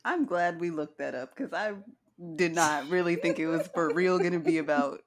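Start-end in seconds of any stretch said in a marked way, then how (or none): 2.4-5.0 loud, laughing through the words; amused disbelief, laughter bubbling through. Real, not acted. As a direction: say it loudly while cracking up. Laughing at fungus festival discovery.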